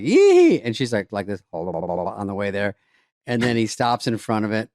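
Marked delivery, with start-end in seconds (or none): 0.0-0.6 high, loud hammed-up yell; rowdy yee. Cocky storm chaser whooping toward the tornado. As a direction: whoop at the twister like a thrill-seeker.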